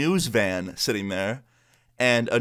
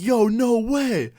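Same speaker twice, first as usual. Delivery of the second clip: high, loud, drawn-out disbelief; stretched-out, starstruck outburst; amazed fan; 12 semitones higher, 5 dB louder, much slower than usual. Retold or imitated. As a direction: imitate a thrilled fan recognizing someone. Fan recognizes the YouTuber.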